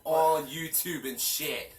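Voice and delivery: loud, slow, high-pitched jab; loud teasing. Pretend. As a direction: drag it out loudly at viewers. Calling out to the viewers.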